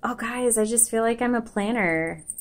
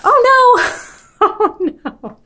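surprised; 10 dB louder, slower than usual. Real, not acted. Something spills while unboxing.

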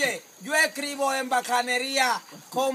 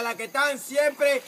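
usual speaking voice

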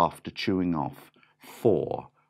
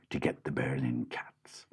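whisper; 6 semitones lower, 9 dB quieter than usual. A narrator's reading. Whispered narration.

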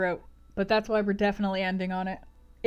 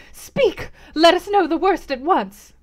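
high, loud, urgent plea; frantic lady's demand; 8 semitones higher, 9 dB louder, slower than usual. Acted. Lady demands news from host, high frantic cry. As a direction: demand the truth in a panic. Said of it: "said she".

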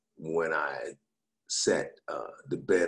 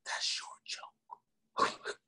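slow, voiceless whisper; stretched-out secretive whisper; hushed whisper; 7 dB quieter, much slower than usual. Retold or imitated. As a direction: whisper it discreetly during a show. Whispering to him during show.

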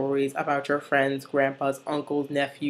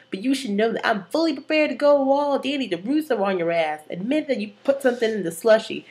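higher-pitched acted threat; Santana; 6 semitones higher than usual. Santana accusing Sebastian over the slushy, high acted threat. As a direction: challenge him in a raised, put-on voice. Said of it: "And then Santana's like".

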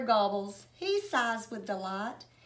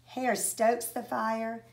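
ordinary delivery, nothing marked

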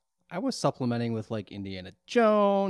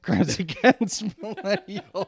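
laughing; 4 semitones higher, 5 dB louder, slower than usual. Real, not acted. Naming the show, laughing.

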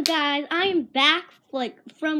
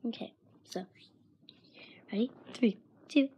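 whispering; 7 dB quieter than usual. Real, not acted. Whispered countdown before tasting.

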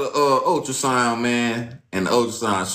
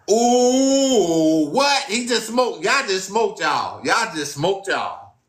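amazed reaction; 10 semitones higher, much slower than usual. Real, not acted. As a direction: react like the verse just knocked you out.

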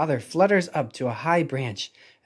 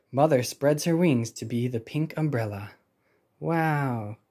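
usual speaking voice

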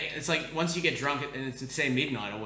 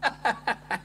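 laughing; 9 semitones higher than usual. Real, not acted. Laughing at retold pizza offer.

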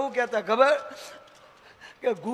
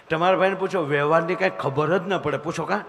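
ordinary talk, nothing marked

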